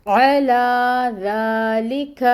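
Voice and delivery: عَلَى ذَلِكَ recited slowly, light lam and kaf, long la; tajweed demo. Shown as a demonstration. Teacher assembling the phrase for students, slow melodic recitation. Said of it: "یہ بنے گا".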